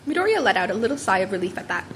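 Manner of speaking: usual speaking voice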